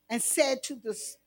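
ordinary delivery

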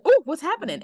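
high, loud delighted gasp; thrilled, hooked on the show; excited. Retold or imitated. Retelling first watching Riverdale.

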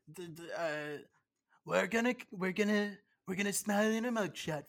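Trump impression. Podcast host shows off his Trump impression to cohost. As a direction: do your best Donald Trump, soothing the crowd. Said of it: "How's my Trump?".

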